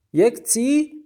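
mock confusion in thick Azeri; Azeri accent. A narrator's reading. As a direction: Azeri shopkeeper teasing. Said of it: "با لهجهٔ غلیظ آذری".

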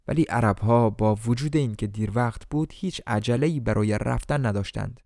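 ordinary delivery

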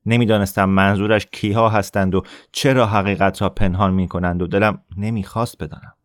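ordinary delivery

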